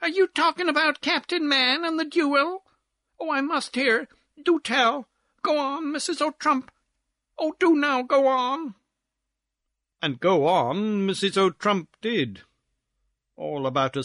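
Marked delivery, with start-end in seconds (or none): 0.0-8.8 high, eager little lady; excited feminine interloper, pitched way up; screamed interruption. Acted. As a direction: beg for the gossip in a high squeal. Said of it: "Here screamed some little feminine interloper".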